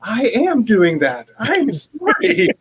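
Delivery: high, slow, drawn-out comeback; hammed-up indignant protest; exasperated mock retort. Acted quarrel, heated retort.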